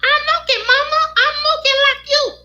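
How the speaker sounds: put-on very high, squeaky Mickey Mouse-like toddler; Justin as a little boy. Little son proudly tells Mama he copies her, squeaky child voice. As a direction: imitate a small son piping up in a very high voice. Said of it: "Justin used to sound like Mickey Mouse".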